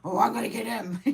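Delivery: dad's angry vow, half laughing. Retold or imitated. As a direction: play the dad's threat through a laugh. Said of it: "all pissed off".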